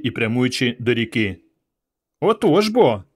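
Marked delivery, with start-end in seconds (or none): 2.2-3.0 put-on high, self-satisfied child; satisfied little boy. A narrator's reading. Small boy wins against Ivan, smugly satisfied. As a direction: have the last word, pleased and high-pitched. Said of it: "вдоволено промовив хлопчик".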